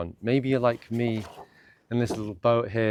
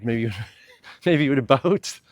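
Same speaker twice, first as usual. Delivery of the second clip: laughing through the words, louder and higher; real amusement; 4 semitones higher, 7 dB louder than usual. Real, not acted. Meditation host tickled by listing boats as places, laughing. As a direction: break into a laugh mid-sentence.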